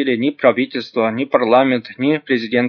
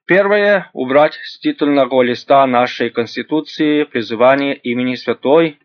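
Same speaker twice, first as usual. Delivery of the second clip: ordinary talk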